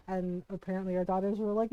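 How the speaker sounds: ordinary talk, nothing marked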